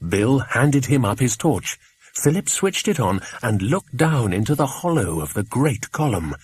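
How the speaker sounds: usual speaking voice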